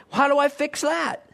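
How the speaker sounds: ordinary delivery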